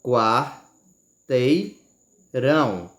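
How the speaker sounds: quar... tei... rão, com pausas longas; sílaba por sílaba. Shown as a demonstration. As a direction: separar "Quarteirão" em três sílabas bem lentas. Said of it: "Fale sílaba por sílaba". ("Quarteirão" silabado, muito devagar)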